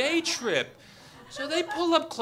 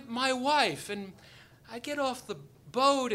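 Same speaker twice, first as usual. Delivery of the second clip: ordinary delivery, nothing marked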